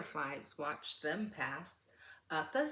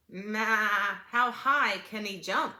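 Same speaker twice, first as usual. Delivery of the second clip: bleating goat; 3 semitones higher, 9 dB louder than usual. Acted. The goat asks about the newcomer, bleating loudly. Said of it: "said Goat".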